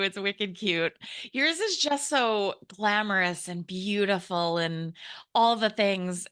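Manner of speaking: usual speaking voice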